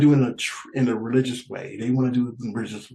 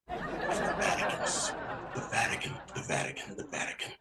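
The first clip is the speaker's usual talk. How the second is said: secretive whisper; 7 semitones higher, 11 dB quieter than usual. Acted. Comic revealing the culprit to the crowd in a fading whisper. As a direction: whisper fading away to nothing. Revealing who took the money.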